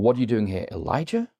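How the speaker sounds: even start, then "Elijah" swings sharply up as a question; questioning stress on ELIJAH. Shown as a demonstration. Preacher showing a fourth reading of God's question, questioning lift on ELIJAH. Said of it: "recited it in four different ways".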